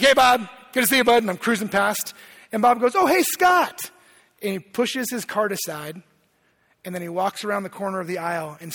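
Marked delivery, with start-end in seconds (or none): 0.0-1.2 loud, curt hello in passing; snippy greeting. Retold or imitated. Hurried shopper greeting a church friend in passing, mock snippy. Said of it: "whatever, snippy, angry personality at the time". 2.9-3.9 high, loud, imitated happy hello; friendly greeting. Church friend spotting the pastor at the store, imitated cheery greeting.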